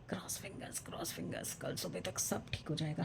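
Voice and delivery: whispered like a good-luck charm; very soft, breath-only wishing; hopeful whisper. Real, not acted. Woman wishing herself luck for tomorrow, hopeful whisper.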